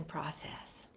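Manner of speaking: breathy whisper. Real, not acted. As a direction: drop to a whisper. Fading whisper at sentence end.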